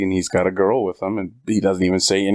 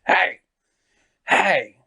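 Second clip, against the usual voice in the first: higher, sharp calls to get attention; mock shout; 9 semitones higher than usual. Girl kicking his chair to wake him, mock shout. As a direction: snap him out of it with a call. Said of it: "She goes".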